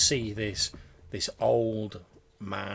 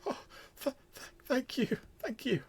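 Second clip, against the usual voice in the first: high, trembling grateful voice; cling to the promise with relief; frail old man. Acted. Old patient thanks the visitor, high and grateful.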